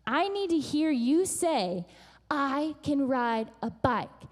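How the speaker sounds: slow, deliberate model sentence; coaching firmly. Retold or imitated. Mother wants Hannah to say it, slow and firm. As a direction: spell it out slowly for a child.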